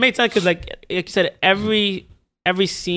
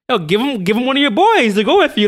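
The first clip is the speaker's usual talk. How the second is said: mock urgent appeal, higher and louder; urging; 6 semitones higher, 4 dB louder than usual. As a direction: plead the case as if to a commander. Acting out what commander should say.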